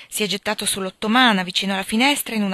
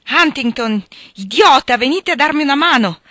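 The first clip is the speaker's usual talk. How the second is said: loud, high furious call; angry yell; 6 semitones higher, 9 dB louder than usual. A narrator's reading. Hattersley angrily shouts at Huntington to help him. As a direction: bark it at the laughing friend. Drunk man yelling for help.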